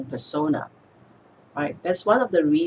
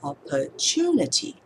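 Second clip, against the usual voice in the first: "opportunity" with "tu" raised and stretched; stress on third syllable. Shown as a demonstration. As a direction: ease into "opportunity", landing on "tu". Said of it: "add a little bit of pause".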